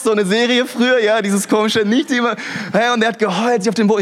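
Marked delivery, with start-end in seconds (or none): 1.8-2.4 put-on higher, lighter replay of the whiny baby line; lightly voiced Dinos baby catchphrase; Dinos baby quote. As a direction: quickly quote the cartoon baby again. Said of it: "die Dinos".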